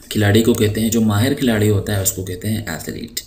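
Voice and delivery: ordinary delivery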